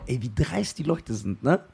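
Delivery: soft, slow inner voice; inner thought, hushed. Retold or imitated. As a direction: wie zu sich selbst, leise und langsam. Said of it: "Und ich denk mir in meinem Kopf". (leise gedachter Satz, langsam)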